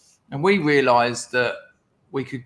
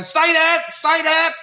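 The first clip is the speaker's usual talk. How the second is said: much higher, louder mock "stay there"; teacher shouting; 5 dB louder than usual. Teacher below yells up at the stuck boy, mock shout. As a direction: call up at the leg through the ceiling. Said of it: "you can hear him going".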